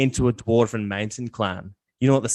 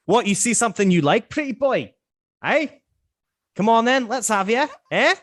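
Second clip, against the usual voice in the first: much higher, rowdy taunting dwarf voice; gruff dwarf challenge; 12 semitones higher than usual. Acted. Dwarf Tyker picking a fight with the pretty boy, high rowdy taunting voice. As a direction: square up and goad him like a brawling dwarf. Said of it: "and he's like".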